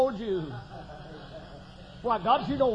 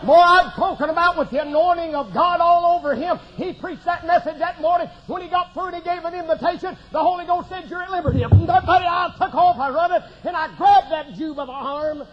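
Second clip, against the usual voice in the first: loud, high revival-preacher delivery; fired-up pulpit shout; full-force preaching; 6 semitones higher, 10 dB louder than usual. Real, not acted. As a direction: preach it hard, voice pushed up and loud.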